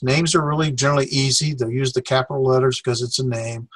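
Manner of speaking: ordinary talk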